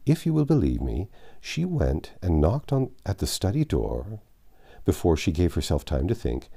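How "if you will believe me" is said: ordinary delivery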